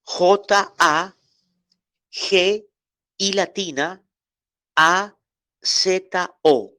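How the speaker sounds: letters called out for students to write down; spelling out letters. Shown as a demonstration. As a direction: dictate the letters of hagiazo one by one. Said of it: "¿Cómo se escribe? Anótelo".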